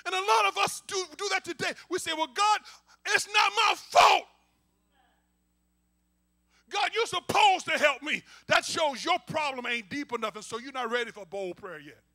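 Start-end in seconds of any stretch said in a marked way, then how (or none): 2.1-8.2 loud, high-pitched complaint; indignant, raised-voice protest; protesting to God. Acted. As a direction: wail it upward in mock self-pity.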